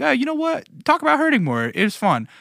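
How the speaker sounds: high-pitched put-on listener voice; imagined listener. Listeners messaging the host, high put-on voice. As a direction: pitch it up, imitating a fan's message.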